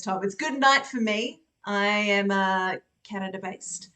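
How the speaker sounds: ordinary delivery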